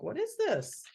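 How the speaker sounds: high, wondering little sheep voice; a sheep amazed at the balloon; curious sheep. Acted. Matilda marvelling at the orange balloon, high and wondering.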